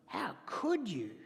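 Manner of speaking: slow, soft wounded question to God; hurt accusation. Retold or imitated. Believer accusing God in prayer, slow and wounded. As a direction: ask it like a betrayed friend. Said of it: "ever prayed like that?".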